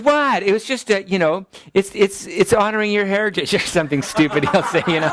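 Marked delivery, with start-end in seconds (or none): 0.0-3.5 put-on teen son protesting. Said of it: "and he goes".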